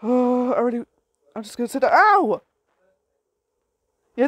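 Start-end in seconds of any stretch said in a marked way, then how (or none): 1.9-2.4 loud, high playful ow; yelp of pain. Pretend. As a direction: yelp as the character gets hit. Player's animal gets hit in game.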